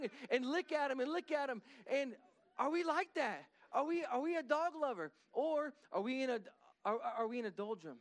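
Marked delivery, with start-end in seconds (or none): none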